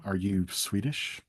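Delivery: ordinary talk, nothing marked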